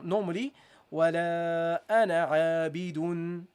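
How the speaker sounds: ولا أنا عابد recited with عابدُن nunation; recited Arabic. Shown as a demonstration. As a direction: chant ولا أنا عابد ending in -dun. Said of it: "You would say".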